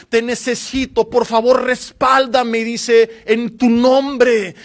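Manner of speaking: loud impassioned preacher retelling King Asa's prayer; full-force preaching. Acted. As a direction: preach it out to the whole church, building to a cry.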